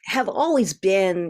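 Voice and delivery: ordinary talk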